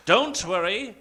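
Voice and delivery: ordinary delivery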